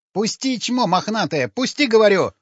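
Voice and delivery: high-pitched protesting cry; kicking, yelling. A narrator's reading. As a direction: yell to be let go, pitch way up. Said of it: "закричал сутенёр, брыкаясь". (визгливый крик протеста)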